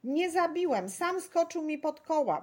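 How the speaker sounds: high, loud protest; accused driver crying out his innocence; shouted denial. A narrator's reading. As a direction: shout back in self-defence. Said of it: "wykrzyknął na to kierowca".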